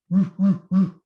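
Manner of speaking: dog answers the call; dog barking. Acted. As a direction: bark like an eager dog. Dog barks.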